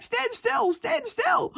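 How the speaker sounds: re-enacted shriek to hold still; imitated screaming. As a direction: mock-scream it in a high panicky pitch. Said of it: "me screaming at her to stay still".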